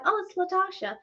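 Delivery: high American-style name; imitated American accent. American girl explaining her name, high imitated American accent. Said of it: "How do you pronounce your name?".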